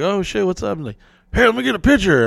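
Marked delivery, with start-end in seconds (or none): 0.0-0.8 surprised. Retold or imitated. 1.3-2.3 high, louder excited ask; mock starstruck fan asking for a picture; eager fan.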